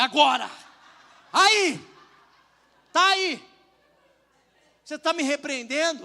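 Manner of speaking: loud, high gotcha shouts of triumph; triumphant shout. Retold or imitated. Pastor shouting back at the friend who rebuked him, triumphant. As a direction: shout it out like you caught him.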